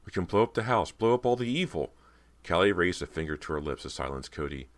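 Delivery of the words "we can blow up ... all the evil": higher, eager urging; excited cry. A narrator's reading. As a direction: push the idea with rising excitement. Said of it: "Cody cried".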